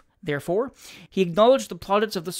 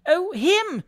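put-on very high little-boy voice; small child; 13 semitones higher, 4 dB louder than usual. A narrator's reading. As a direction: answer as a tearful small child. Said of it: "replied the child".

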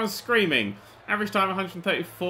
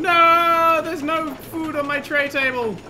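complaining guest; 9 semitones higher, 5 dB louder, slower than usual. Pretend. Voicing a complaining ride guest.

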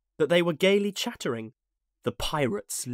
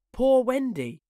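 high, falling wail of a weary bird; plaintive moan; 11 semitones higher, 4 dB louder than usual. A narrator's reading. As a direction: moan "Poor Wendy" mournfully. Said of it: "it moans".

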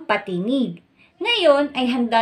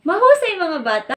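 high, loud burst of praise; excited praise; 6 semitones higher, 4 dB louder than usual. Real, not acted. Teacher praising the children after the drill, high and excited. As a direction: cheer the class on with delight.

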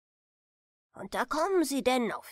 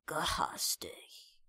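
softer, unvoiced whispered question; whispered echo; 8 semitones lower, 8 dB quieter than usual. A narrator's reading. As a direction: echo the word back in a hush. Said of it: "sagte der alte Großvater". (ungläubig geflüstert)

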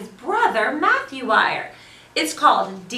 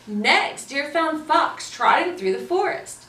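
ordinary delivery, nothing marked

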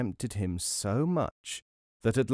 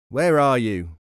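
loud searching call; raised voice falling at the end; 7 dB louder than usual. A narrator's reading. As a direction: call out for someone hidden. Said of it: "they called".

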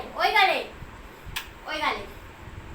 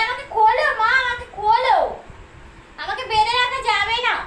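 higher, louder pleading as the pet; voicing the pet's plea; 6 semitones higher, 10 dB louder than usual. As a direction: beg to be untied in the pet's voice. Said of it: "কান্নার এরকম". (পোষা প্রাণীর গলায় জোরে আকুতি)

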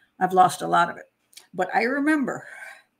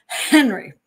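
loud, raised mock-stern call; angry teacher; 4 semitones higher, 6 dB louder than usual. Teacher rounds on the pupil, mock angry. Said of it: "the teacher gets very angry".

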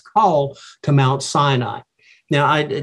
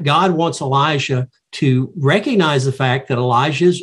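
usual speaking voice

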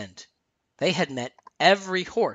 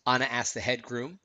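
usual speaking voice

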